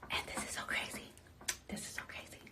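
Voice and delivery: hushed, breathless whisper; excited late-night whisper. Real, not acted. Whispering late at night, child asleep.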